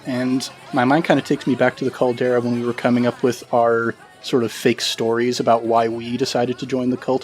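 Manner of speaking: ordinary talk, nothing marked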